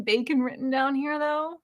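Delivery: ordinary talk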